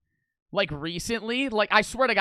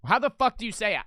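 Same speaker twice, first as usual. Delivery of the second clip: ordinary talk, nothing marked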